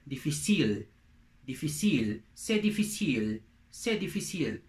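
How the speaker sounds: "difficile" as dee-fee-SEEL, French; French pronunciation. Shown as a demonstration. Teacher gives difficult in French, French pronunciation. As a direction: model "difficile" with stress on the last syllable. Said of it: "In French, you say".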